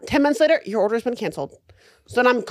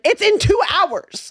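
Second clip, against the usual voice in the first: high, slow, drawn-out insistence; retold exasperation; 8 semitones higher, much slower than usual. Customer to corporate about cancelled balloons, exasperated. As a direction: stretch it out at a raised pitch, exasperated.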